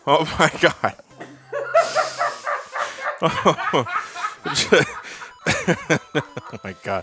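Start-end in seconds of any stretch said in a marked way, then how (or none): 1.5-5.0 hysterical laugh. Real, not acted. Watching friend fail.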